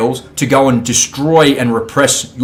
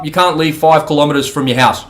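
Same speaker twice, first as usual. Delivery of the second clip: ordinary delivery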